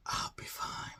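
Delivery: hushed unvoiced whisper. [name] brushing off the worried clerk, whispering.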